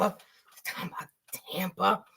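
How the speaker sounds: fast unvoiced whisper, fading quiet; soft whispered catchphrase; whispered tease. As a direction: hush it out quickly as a joke. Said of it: "playful catchphrase aimed at Tampa".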